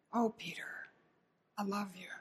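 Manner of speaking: hushed, softly breathed affection; tender love. Retold or imitated. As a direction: speak very softly to someone you love. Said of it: "searching gaze of love".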